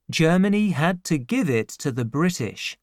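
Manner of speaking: ordinary delivery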